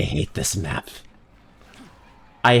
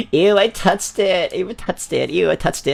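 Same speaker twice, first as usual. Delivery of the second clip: grossed out; 6 semitones higher than usual. Real, not acted. Grossed out by touching something.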